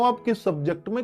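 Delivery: ordinary delivery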